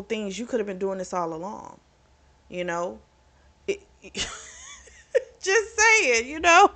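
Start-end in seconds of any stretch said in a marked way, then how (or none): 3.7-6.7 squeaky high laugh breaking into the words; giggling while talking. Real, not acted. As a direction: laugh through the aside. Laughing through own aside.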